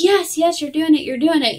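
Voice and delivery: lightly acted delivery-room cheerleading; mock cheering on. Staff cheering on a pushing mother.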